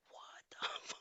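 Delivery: barely voiced, shocked whisper; stunned whisper. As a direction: whisper it in disbelief. Stunned reaction to the event.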